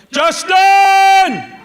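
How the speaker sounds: full-throated sideline bellow; yelling dad. Retold or imitated. Dad yelling at his son from the sideline, bellowing. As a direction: roar the kid's name across the field. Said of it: "starts yelling at his son".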